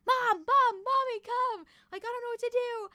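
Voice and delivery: high-pitched yelling, easing in volume; retold scream. Hurt daughter screaming for mom from the floor, retold scream. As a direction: cry out high, imitating a call for help. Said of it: "I continue to scream".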